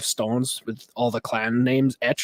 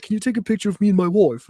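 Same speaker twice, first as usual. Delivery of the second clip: lightly voiced English tourist; higher, quick, polite English request; nice English guy; 7 semitones higher, 5 dB louder, faster than usual. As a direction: play a nice English visitor. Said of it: "like, this nice English guy".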